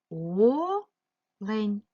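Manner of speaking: "Олень" with a long rising first vowel, a gap, then the rest; split into syllables. Shown as a demonstration. Teacher dividing a word for pupils, syllable by syllable. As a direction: stretch and separate both syllables of "Олень". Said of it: "поділити ці слова на склади".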